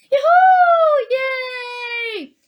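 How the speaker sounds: high, loud thrilled shriek. A narrator's reading. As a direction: squeal into the dark. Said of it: "shrieked Violet Beauregard".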